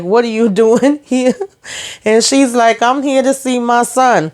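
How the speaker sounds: half-laughing mock confrontation; amused retelling. Real, not acted.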